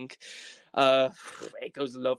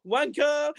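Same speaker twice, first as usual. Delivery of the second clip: high, loud, drawn-out panicked rant; frantic yelling; 13 semitones higher, 7 dB louder than usual. Acted. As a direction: yell down the phone in exaggerated outrage. Frantic call to bank in crisis.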